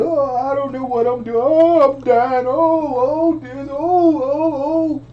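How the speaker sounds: hammed-up sobbing in pain; mock boo-hooing. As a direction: imitate her crying and moaning. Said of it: "she was just boo-hooing".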